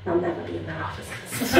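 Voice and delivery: whispered aside. Real, not acted. Aside, much softer, unvoiced.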